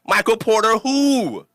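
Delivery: slow, mocking who; mock dismissive. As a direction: brush the rival off like he's nobody. Mocking his rival after the follow.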